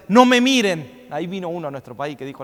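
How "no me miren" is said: pompous minister's demand. Retold or imitated. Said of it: "le decían".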